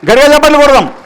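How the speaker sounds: loud, high shout to the hall; rousing emcee cry; applause call. Real, not acted. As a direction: get everyone clapping hard. Calling for applause.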